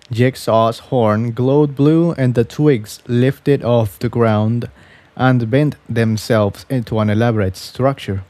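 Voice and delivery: ordinary delivery, nothing marked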